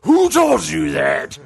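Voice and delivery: slow Batman-like growl; demonic God. Acted. God interrupts the doubting man, slow demonic growl. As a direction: growl it out slowly. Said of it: "some demonic".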